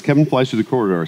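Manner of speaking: ordinary talk, nothing marked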